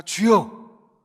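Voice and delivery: sudden loud cry of Lord; Peter's cry. Acted. Peter cries out to Jesus, loud alarmed cry. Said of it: "베드로가 뭐라고 합니까?".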